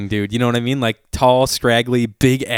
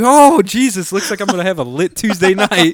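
high, loud mock alarm tipping into glee; amazed delight; 8 semitones higher, 5 dB louder than usual. Customer handed a whole pitcher of margaritas, amazed delight. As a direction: exclaim it big for a comic payoff.

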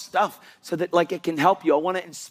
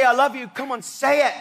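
mock demanding; 6 semitones higher than usual. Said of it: "God didn't say".

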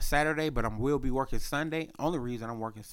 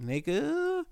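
pitched-up slang; 13 semitones higher than usual. Retold or imitated. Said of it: "they are on shit like".

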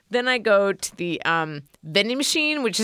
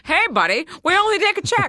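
1930s mid-Atlantic wiseguy, pitched higher; mid-Atlantic tough guy; 9 semitones higher, faster than usual. Pretend. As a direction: bark it like an old-movie heavy in mid-Atlantic. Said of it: "say that in a mid-Atlantic accent".

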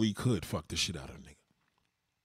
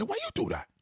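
put-on high-pitched Haitian-accented protest; mock Haitian dad, squawking the question; Haitian father. His line for Taylor the Natural, high Haitian accent. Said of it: "I'm gonna say".

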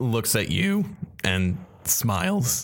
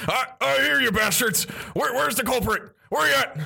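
blind blustering captain; 14 semitones higher than usual. Acted. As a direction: shout blindly at the unseen.

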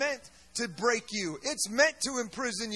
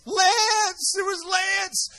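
excited reveal; 7 semitones higher, 6 dB louder, much slower than usual. Real, not acted.

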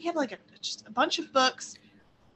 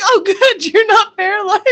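relieved mother; 11 semitones higher, 11 dB louder than usual. Retold or imitated. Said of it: "She was like".

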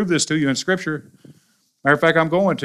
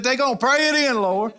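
high mock church-lady lilt; old church ladies; 10 semitones higher than usual. Pastor mocking the church ladies' refrain, lightly voiced. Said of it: "they never said what they were praying in".

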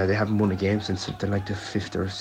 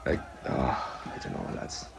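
despairing whisper; 7 semitones lower, 6 dB quieter, slower than usual. Real, not acted. Exasperated over poor team performance.

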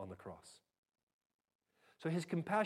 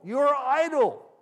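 much higher and louder, slow "You are idle"; escalating shout; 10 semitones higher, 12 dB louder, slower than usual. Acted. Pharaoh shouting at the Hebrews, furious escalation.

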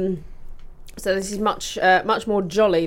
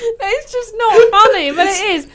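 high, loud, wailing through laughter; almost crying with real laughter; helpless laughter; 11 semitones higher, 7 dB louder than usual. Real, not acted. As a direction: protest it while losing control to giggles. Protesting while everyone is in hysterics.

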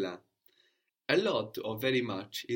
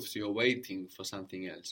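ordinary talk

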